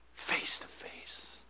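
slow, hushed awe; whisper. Real, not acted. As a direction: breathe it like a secret. Tender vision of seeing Christ.

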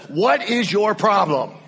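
loud, exasperated donkey voice; a donkey fed up with the beating; talking donkey. Acted. Donkey to its master, loud and exasperated. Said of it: "the donkey turns around and says".

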